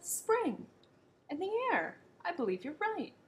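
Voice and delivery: high, thrilled discovery; excited. Acted. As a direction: burst out with the big idea. Gumble's sudden idea.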